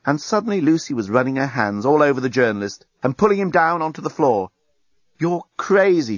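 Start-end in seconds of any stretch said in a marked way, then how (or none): none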